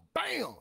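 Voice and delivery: sharp high loud bang; phone slam. Retold or imitated. Comic imitating grandma slamming the phone.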